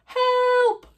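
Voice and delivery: high loud panicked cry; cry for help. A narrator's reading. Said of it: "cried the mother".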